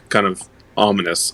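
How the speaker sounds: ordinary talk, nothing marked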